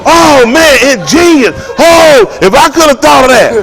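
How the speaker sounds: high, loud, amazed exclamation; hammed-up amazement at an invention; amazed shout. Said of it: "watch it now".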